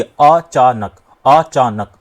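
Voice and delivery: "अचानक" broken as अ-चा-नक, last syllable kept whole. Shown as a demonstration. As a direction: say "अचानक" in three separate beats, अ, चा, नक. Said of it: "अब आप इनको बोलिए". ("अचानक" को अ-चा-नक तोड़कर बोलें)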